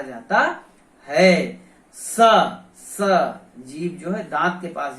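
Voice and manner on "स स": स said crisply with the tongue at the teeth; a clean hissed स from the teeth; dental sa demo. Shown as a demonstration. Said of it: "इसका उच्चारण दांत से होता है".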